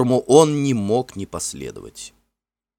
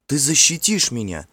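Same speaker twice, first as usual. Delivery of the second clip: pleading; 7 semitones higher than usual. A narrator's reading. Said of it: "взмолилась она".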